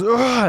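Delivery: high, mock pained groan; a hurt heckler's groan. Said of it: "he goes".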